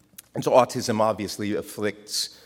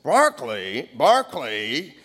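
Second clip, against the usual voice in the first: sudden, stuttering blurt, lightly imitated; spurting out; 3 semitones higher, 3 dB louder than usual. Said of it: "he'd spurt out".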